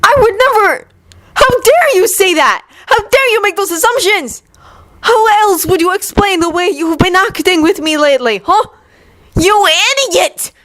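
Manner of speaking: very high, shouting outrage; furious outburst. Acted. Yuri furiously denies Victor's charge. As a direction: scream it at him, outraged.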